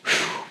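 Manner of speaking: long, voiceless blown-out breath; heavy exhale. Real, not acted. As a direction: let out a weighty "whew". Pause after the betrayal quote.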